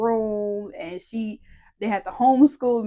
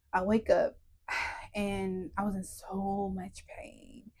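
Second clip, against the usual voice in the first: pained hush; 3 semitones lower, 9 dB quieter than usual. Real, not acted. Waking in pain.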